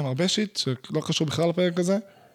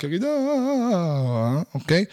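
mock frilly wailing; slower than usual. Mocking himself wailing over his pain to the listeners, hammed-up trilling cry. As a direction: ham up a trilling cry like a melodrama. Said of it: "בוכה בסלסולים".

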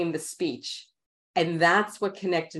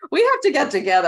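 high, loud eager exclamation; high loud excited we have to get together; excited invitation; 11 semitones higher, 9 dB louder, faster than usual. Real, not acted. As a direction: gush with delight. Excited bonding with host.

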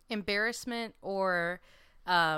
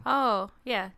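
normal talking voice

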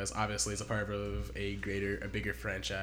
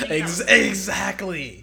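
laughing; 9 semitones higher, 14 dB louder, much slower than usual. Real, not acted. Cracking up at accent teasing.

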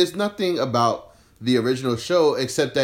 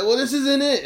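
much higher whiny fan voice; 14 semitones higher, 3 dB louder than usual. Retold or imitated. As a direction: whine it like a sulky fan. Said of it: "fans are like".

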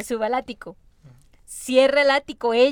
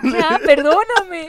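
slow, louder, higher crying plea with a laugh; mock sobbing plea; 5 semitones higher, 9 dB louder, much slower than usual. Friend imitating the boy begging his mother, mock tearful plea. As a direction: beg forgiveness, half laughing, half sobbing. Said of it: "y le dice".

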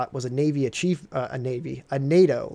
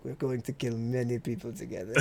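mock Lithuanian soldier; 4 semitones lower, 11 dB quieter than usual. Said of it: "He's like".

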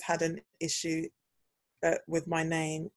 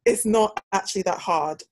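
normal talking voice

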